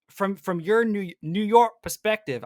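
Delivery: attempted New York accent, slow and strained; a failed stab at New York; bad New York accent. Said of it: "I can't do accents".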